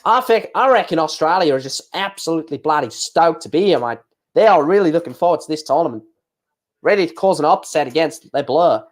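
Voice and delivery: put-on, hammed-up Australian accent; mock Aussie accent, lively lilt. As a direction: play a keen Aussie fan, broad accent. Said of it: "gonna have to do an Australian accent".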